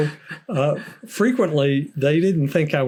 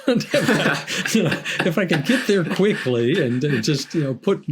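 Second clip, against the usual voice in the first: laughing through the words, much higher; 4 semitones higher than usual. Real, not acted. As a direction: giggle while picturing the trip. Laughs at the penguin cruise idea.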